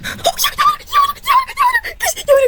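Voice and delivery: squealed yeahs, very high; high-pitched squeal. Real, not acted. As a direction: shriek it with hope. Gacha pull hoping for character.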